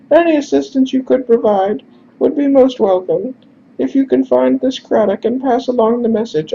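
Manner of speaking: grateful falsetto, formal wording; high-pitched, delighted character. Acted. Messenger welcomes offered help, delighted high-pitched voice. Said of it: "Bane's face lights up like a lantern".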